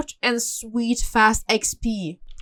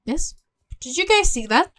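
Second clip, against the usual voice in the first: ordinary talk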